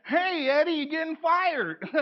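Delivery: high, slow, crying-laughing taunt; mock taunting coworker. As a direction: rub it in gleefully. Mock coworker taunting a fired colleague.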